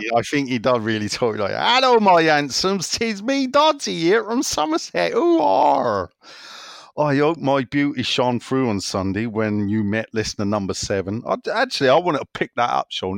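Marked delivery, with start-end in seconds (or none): 1.8-11.2 big, loud Somerset yokel voice; high, exaggerated West Country greeting; Doddsy's Somerset accent. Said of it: "I will do his accent".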